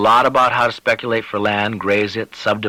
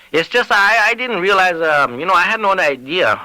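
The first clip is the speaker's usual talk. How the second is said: higher, stammering excuse; flustered Lavadier; 9 semitones higher, 3 dB louder than usual. Acted. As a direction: fumble for an excuse.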